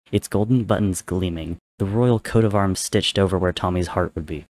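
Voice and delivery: plain reading voice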